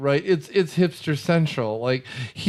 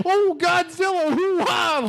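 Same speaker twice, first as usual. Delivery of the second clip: much higher, slow goofy cartoon yelp; cartoon comic relief; 13 semitones higher, slower than usual. Mocking the movie's comic-relief character, goofy cartoon yelp. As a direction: play the hammy punchline guy. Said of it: "the movie's like".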